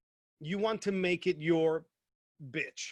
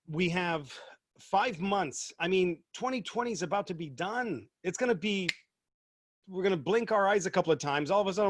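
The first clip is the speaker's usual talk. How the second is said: usual speaking voice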